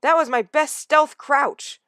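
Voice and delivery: slow, high, loud offended protest; hurt young cat insisting; indignant. Acted. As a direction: defend your pride, drawn out. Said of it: "round with indignation".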